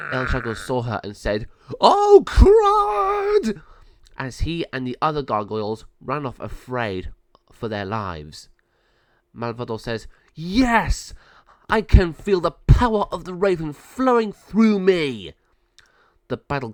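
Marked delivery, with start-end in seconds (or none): 1.8-3.6 very high, loud frightened cry; terrified yelp. Acted. El Jago yelps in terror at the raven. Gargoyle sees giant raven. 10.3-15.3 high, loud gloating exultation; triumphant villain. Acted. Malvado exults in her new raven power, loud.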